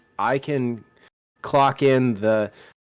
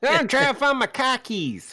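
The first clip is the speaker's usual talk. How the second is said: mock Yankee accent; 13 semitones higher than usual. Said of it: "you sound like a Yank".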